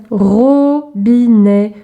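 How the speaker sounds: very slow, held syllables; drawn-out teacher's demonstration. Shown as a demonstration. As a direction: stretch "Robinet" into three long beats. Syllable exercise, picture word.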